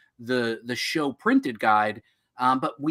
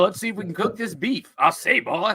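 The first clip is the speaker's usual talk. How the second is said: Foghorn Leghorn; 7 semitones higher than usual. Retold or imitated. Riffing on cooking beef in a cartoon rooster voice.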